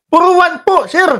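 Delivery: squeaky high mimicry of a witness; mock high witness voice. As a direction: pitch way up, mocking the witness. Mock voicing a hearing witness.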